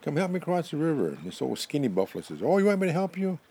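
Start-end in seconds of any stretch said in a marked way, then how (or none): 0.0-1.1 high, loud holler that eases in volume; yelling. Acted. Porcupine yells to buffalo for help across the river, hollering. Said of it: "So he yells". 2.4-3.4 high-pitched, loud storybook question; calling back. Acted. Old skinny buffalo answers porcupine's yell, calling back.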